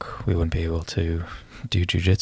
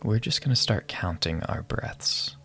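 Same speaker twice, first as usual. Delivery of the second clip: calm breath-counting guide voice; guided meditation. Acted.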